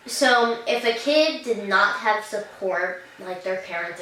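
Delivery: normal talking voice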